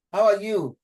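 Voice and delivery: high falling "are" in How are you; tone demo, high take. Shown as a demonstration. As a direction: lift the whole question high and drop at the end. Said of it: "I would take it like".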